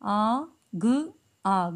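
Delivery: आ and ग drawn out slowly, then joined as आग; teacher sounding out a word very slowly; slow blending. Shown as a demonstration. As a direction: sound out each letter, then glue them. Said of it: "जोड़ कर".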